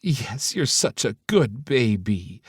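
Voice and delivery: high, slow cooing grandma voice. A narrator's reading. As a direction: coo over the newborn baby. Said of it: "Leslie cooed".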